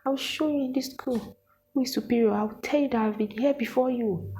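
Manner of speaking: high, menacing promise; threatening lecturer. Retold or imitated. Lecturer threatening the student about who is superior, high and menacing. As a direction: threaten her as if you run the school. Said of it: "she was just like".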